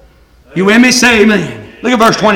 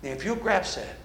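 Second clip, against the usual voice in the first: quiet closing appeal; 14 dB quieter than usual. Real, not acted. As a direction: bring the congregation gently to stillness. Preacher's soft closing appeal.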